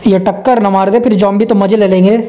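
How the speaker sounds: ordinary talk, nothing marked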